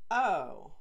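loud, startled oh; suddenly getting the joke; surprised oh. Acted. Kai realizing.

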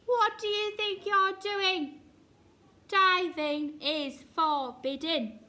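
loud, high scolding; outraged bossy shout; yelled. A narrator's reading. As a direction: yell a telling-off, slowing for the rule. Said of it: "Duck yelled".

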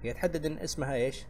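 ordinary talk, nothing marked

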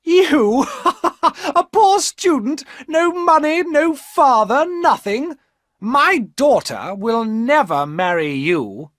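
very high, loud cackling scorn; mocking old woman. A narrator's reading. Old woman mocking the poor student, high and loud. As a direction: laugh him out of the room. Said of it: "The old woman laughed".

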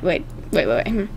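normal talking voice

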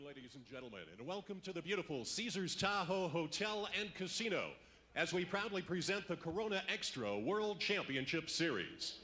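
usual speaking voice

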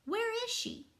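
high-pitched, demanding question; shouting. A narrator's reading. Conductor looking for missing Piccolo, shouting a question. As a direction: demand it, voice jumping up. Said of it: "he shouted".

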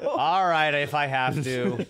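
hammed-up grudging consent, slowing down; mock reluctant. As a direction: play at giving in, loud then dragged out. Joins in acting grudging convert.

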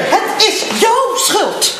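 slow, drawn-out shout on jouw; shouted accusation. Acted. Woman blaming Michels, slow shouted accusation. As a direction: hammer the blame home.

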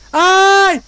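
high, loud startled yelp; startled cry. Real, not acted. Player spots the neighbours in the game, loud startled cry. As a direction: cry out at a sudden scare.

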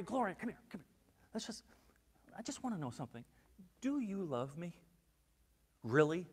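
soft, halting, intimate asking; gentle private question. Retold or imitated. Jesus asking Gloria alone, quiet and searching. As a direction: take someone aside and ask quietly.

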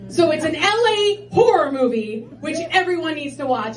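ordinary talk, nothing marked

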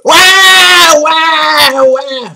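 high imitated baby bawl, starting loud and fading; demanding wail. Astrologer voicing Aries moon energy, demanding baby wail. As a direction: bawl "wah" like a needy infant. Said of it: "demanding energy".

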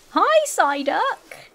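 high, very slow stretched-out hi; cooing greeting. Real, not acted. Trying to win over Psyduck, a slow high coo. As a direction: coax a wild Psyduck closer.

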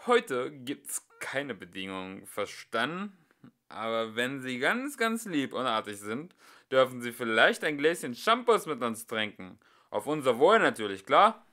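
loud jolly boasting, rising to a shout; cheerful tipsy bluster, loud. Acted. Singer calls to the couple, loud and cheerful. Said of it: "sagte er laut und fröhlich".

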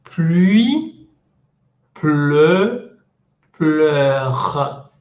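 "Pluie" with I, then "pleu" and "pleure" with E; contrast drill. Shown as a demonstration. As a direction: contrast the three words clearly. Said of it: "La única diferencia aquí es pluie".

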